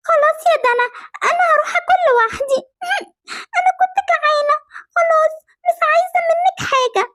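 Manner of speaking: put-on very high, whimpering child voice; tearful sulk. Little sister rebuffed by big sister, tearfully sulking. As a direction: give up, hurt and tearful.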